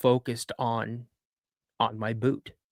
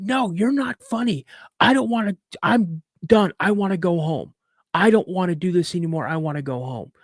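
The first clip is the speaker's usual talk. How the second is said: put-on upset child protest; 6 semitones higher than usual. Boy tells dad he wants home, high upset protest. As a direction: plead like a kid who has had enough.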